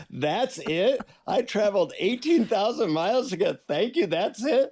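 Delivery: high, rising incredulous questions; mock disbelief. Let-down traveler questions the guru's tiny mantra, in mock disbelief. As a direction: play up the outraged disappointment.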